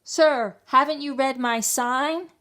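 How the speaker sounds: high, loud refusal through the door; shout. A narrator's reading. Mouse dentist refusing the fox, shouting. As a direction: call out firmly from the window. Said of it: "Doctor DeSoto shouted".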